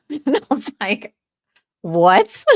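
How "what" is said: loud, startled retold question; shocked disbelief. Landlord reacting to tenant's fire call, shocked disbelief. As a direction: burst out with mock shock.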